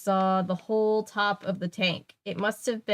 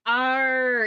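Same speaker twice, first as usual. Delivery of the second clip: loud, higher monster bellow; monster roar; 4 semitones higher, 5 dB louder than usual. A narrator's reading. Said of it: "it bellowed".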